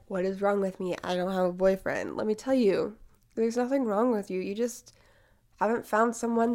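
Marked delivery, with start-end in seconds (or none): none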